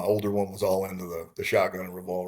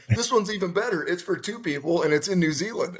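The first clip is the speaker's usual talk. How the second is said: eager wife; 8 semitones higher than usual. Retold or imitated. Voices wife pitching the second bid.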